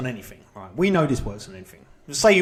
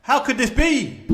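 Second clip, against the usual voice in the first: hammed-up dismay, higher, louder and slowing; mock dismay; 7 semitones higher, 4 dB louder than usual. Cook hearing the dish is only all right, in mock dismay. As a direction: play the crushed cook, a touch over the top. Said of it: "And you're like".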